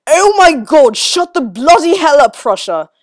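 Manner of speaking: loud furious yell at Prussia; angry shout. A narrator's reading. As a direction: bellow it in exasperation. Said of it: "Arthur shouted at him".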